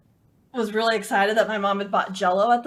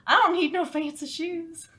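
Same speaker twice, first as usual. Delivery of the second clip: put-on high, twangy Southern kid; a stubborn little girl with a Southern accent; 8 semitones higher, 3 dB louder than usual. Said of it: "Southern accents".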